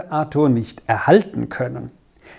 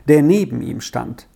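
usual speaking voice

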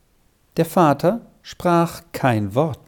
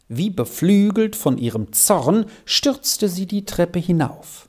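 ordinary delivery

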